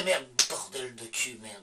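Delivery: put-on understated French accent cursing; mock French accent. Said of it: "je reprends mon accent français".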